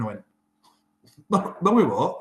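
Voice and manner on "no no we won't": high, loud, emphatic no; indignant refusal. Retold or imitated. To the physio, a raised, indignant refusal. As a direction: shoot down the physio's plan with disbelief.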